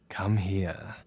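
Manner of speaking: breathy intimate whisper, barely voiced; whispered invitation. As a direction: draw the lover close in a hushed whisper. Seductive invitation to lover.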